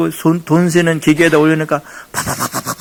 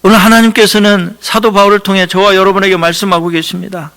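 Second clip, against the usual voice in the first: usual speaking voice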